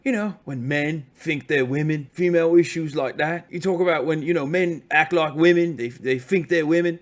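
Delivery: mock British bellboy voice; British accent, earnest explaining, caricatured; imitated British accent. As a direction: put on a working-class British accent.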